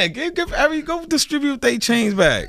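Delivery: high giggling talk; high laughing disbelief. Real, not acted. Laughing about chain snatching.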